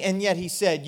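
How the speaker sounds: ordinary talk, nothing marked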